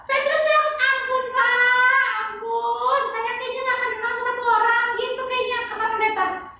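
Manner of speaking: put-on high, sing-song little girl voice; chirpy kid puppet answering the pastor; high child puppet voice. [name] acting out Peter.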